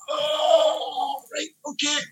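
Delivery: high, thrown-off 'oh, right, okay'; squeaky startled realisation; mock surprise. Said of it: "And I was like".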